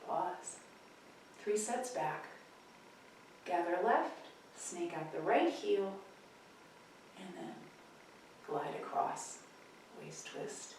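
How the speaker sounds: quiet, unhurried tai chi cues; calm class instructor counting through moves; soft movement cues. Acted. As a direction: guide each move gently.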